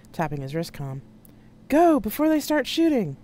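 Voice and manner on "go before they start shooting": high, loud yell; loud command, pitched way up; urgent shouted order. Acted. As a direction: shout to get moving under fire. Urgent order under threat of gunfire.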